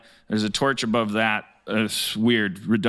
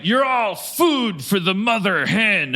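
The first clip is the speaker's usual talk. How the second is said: gloating villain; 8 semitones higher, slower than usual. Acted. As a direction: savour the threat like a villain. Villain gloats over prisoners.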